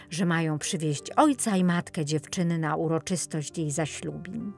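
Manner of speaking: ordinary delivery, nothing marked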